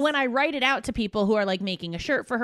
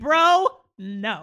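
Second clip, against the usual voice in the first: louder, drawn-out mock disgust; appalled rebuff; 5 dB louder than usual. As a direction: shut it down, appalled. Reacting to the Philly accent.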